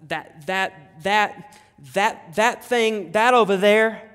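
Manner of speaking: halting, tongue-tied stutter that builds. Acted. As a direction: get stuck repeating the word, overcome. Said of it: "to stutter".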